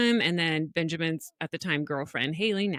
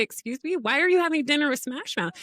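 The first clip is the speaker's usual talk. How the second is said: quick, raised-pitch mock indignation; incredulous mom; 6 semitones higher, faster than usual. Mom texts son about dinner with band, incredulous. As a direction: question a son in disbelief. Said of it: "I text him back".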